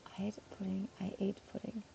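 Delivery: soft whispered test; trying the words in a hush. Real, not acted. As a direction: whisper it to yourself. Quietly testing the words.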